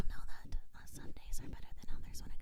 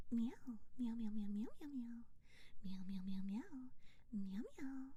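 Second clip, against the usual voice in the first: cat meows; 6 dB quieter than usual. Pretend. Streamer imagining an April Fools meow stream, meowing. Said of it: "and it's just".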